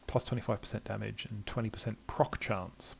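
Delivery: ordinary talk, nothing marked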